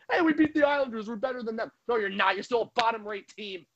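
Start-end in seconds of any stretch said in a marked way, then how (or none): 0.1-1.7 mocking bragging fan. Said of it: "still wouldn't shut up".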